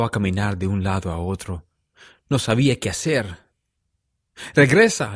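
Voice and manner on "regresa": loud, higher command; urgent order. A narrator's reading. José sending Martin back to search, urgent order. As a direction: order him back, forceful and raised. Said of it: "le ordenó a Martin".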